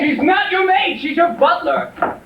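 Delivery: high, furious correction; exasperated yell. Acted. Husband corrects partner about the butler, high exasperated yell. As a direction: shout back, stretching the last words. Yells back in quarrel.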